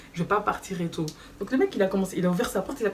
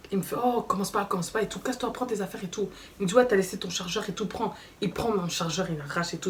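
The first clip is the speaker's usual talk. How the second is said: usual speaking voice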